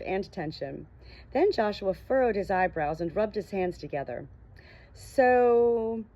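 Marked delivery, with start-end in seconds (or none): 4.9-6.0 a long hesitant sooo; awkward, dragged-out opener; drawn-out awkward so. A narrator's reading. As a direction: stretch the word so out, unsure. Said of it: "he dragged the word out awkwardly".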